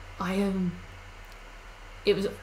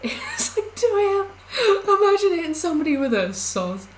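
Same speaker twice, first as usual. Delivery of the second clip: high squealing laugh through words; laughing hard; 10 semitones higher, 6 dB louder than usual. Real, not acted. Laughing at the polite apology at 2 AM. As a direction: crack up while talking.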